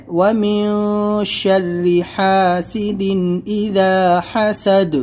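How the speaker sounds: slow, ن of وَمِن hidden before ش; tajweed demo. Shown as a demonstration. Teacher recites the last verse slowly. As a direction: hide the ن of وَمِن, clear ح in حَاسِدٍ. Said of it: "اخفا کرنا ہے".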